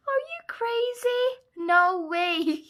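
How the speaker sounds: high, loud squeal of disbelief; real disbelief. Real, not acted. Crafter opening a gift box, stunned squeal.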